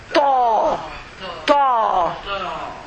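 ta said heavy and deep, mouth rounded. Shown as a demonstration. Teacher showing the heavy T again. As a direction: say ta heavy with a pressed tongue. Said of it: "But when I want to pronounce the heavy T".

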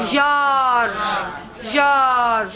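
"Jar" with a long drawn-out aa; stretched teaching model of "Jar"; long vowel. Shown as a demonstration. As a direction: hold the vowel in "Jar" steadily. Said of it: "The alif is a long vowel".

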